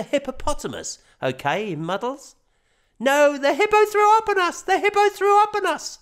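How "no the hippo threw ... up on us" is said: put-on high, loud little boy protesting; child voice. A narrator's reading. Boy protesting innocence to dad, high loud child voice. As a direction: insist like a small boy caught out. Boy insisting to his father.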